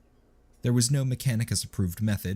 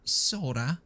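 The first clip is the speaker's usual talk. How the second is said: hesitant; 7 semitones higher than usual. A narrator's reading. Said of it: "Nubby's hesitant reply".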